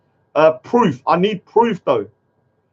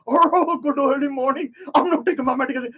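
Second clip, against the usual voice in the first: mocking imitation; 8 semitones higher than usual. Mimicking the rambling man.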